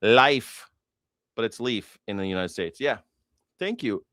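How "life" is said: Life said as "life", rhyming with wife; Norse pronunciation. Shown as a demonstration. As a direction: say Life with a long i, like the word life. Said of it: "it's pronounced life".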